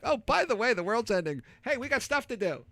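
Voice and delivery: imitating a coworker who shrugs off disaster; Tex's breezy manner. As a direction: toss off the end of the world like nothing. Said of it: "that's his demeanor".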